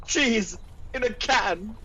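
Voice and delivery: high, slow aghast cry; horrified shock. Acted. Kingsley reacts to the American threat, in high, horrified shock. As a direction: recoil in mock horror.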